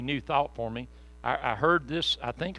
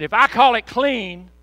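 much higher, louder, slow booming; God's voice; 8 semitones higher, 12 dB louder than usual. Acted. God declaring to Peter, booming slow. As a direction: proclaim it from heaven. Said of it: "And God said".

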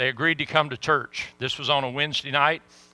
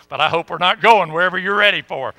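laughs through it, louder and higher; laughing; 4 semitones higher, 8 dB louder than usual. Real, not acted. Teasing the boy in gang clothes, laughing aloud. Joking about the gang outfit.

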